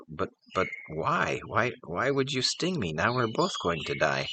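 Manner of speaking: a betrayed frog pleading why; dismayed dying frog. Acted. Poisoned frog asking the scorpion why, in stammering dismay.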